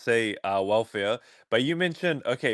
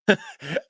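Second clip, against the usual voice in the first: short high laugh; 10 semitones higher than usual. Real, not acted. Laughs at the accent question.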